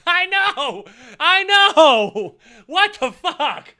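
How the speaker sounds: loud laughing disbelief. Real, not acted. Streamer laughing loudly at a viewer's comment. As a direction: crack up while shouting it.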